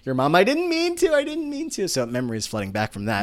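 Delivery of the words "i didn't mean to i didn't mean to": put-on much higher, kid's whimpering plea; whiny crying kid. A kid whining to mom after hurting a friend. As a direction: whine it like a little kid running to mom. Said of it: "go crying to, like, your mom".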